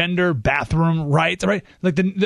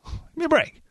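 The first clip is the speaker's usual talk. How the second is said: exasperated scoff; 12 semitones higher, 3 dB quieter than usual. Real, not acted. Host scoffs at Democrats.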